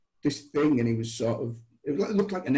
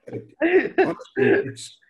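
laughing; 13 semitones higher, 7 dB louder, slower than usual. Real, not acted. Cracking up over broadband network.

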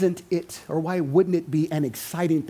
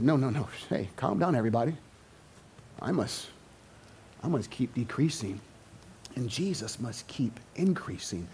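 soft, low, calming voice; John soothes his followers, much softer and lower; calm reassurance; 8 semitones lower, 11 dB quieter than usual. Acted. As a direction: settle everyone down gently.

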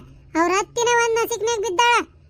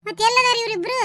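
ordinary delivery

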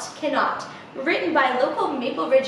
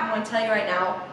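usual speaking voice

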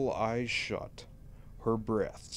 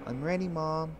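put-on higher child voice, eager; little girl; 9 semitones higher than usual. Daughter tells Mom she's ready, child voice. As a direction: pitch up into an obedient little daughter. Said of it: "she said to me".

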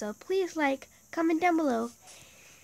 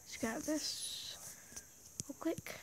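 whispering; 3 semitones higher, 11 dB quieter, slower than usual. Real, not acted. Sneaking whisper while playing.

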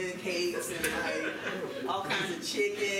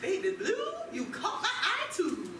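flirty rhyming; 8 semitones higher than usual. Acted. Jerry flirting in rhyme.

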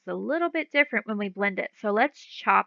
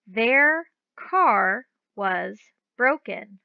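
very slow, clear sentence reading; slow read-aloud; much slower than usual. Shown as a demonstration. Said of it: "our first one says".

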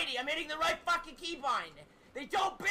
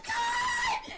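high, slow, furious scream; rage scream. Real, not acted. Gamer screaming at the game that won't build, high rage scream. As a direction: scream it at the screen in pure fury.

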